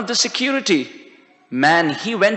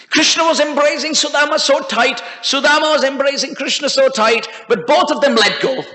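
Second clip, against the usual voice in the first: ordinary delivery, nothing marked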